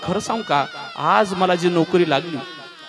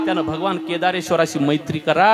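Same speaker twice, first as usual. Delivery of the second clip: ordinary talk, nothing marked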